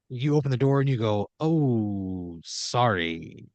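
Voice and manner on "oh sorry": drawn-out, lower apology; sheepish apology, words stretched out; awkward apology. Acted. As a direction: back out with a low, slow "oh, sorry". Walking in on a crime.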